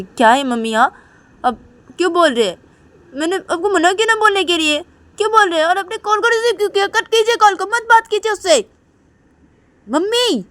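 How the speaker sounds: high, loud and fast scolding; angry daughter. Acted. Daughter scolds mom for answering the call, high angry yell. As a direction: snap at mom like a furious young girl. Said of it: "काजल अपनी mummy को बोलती".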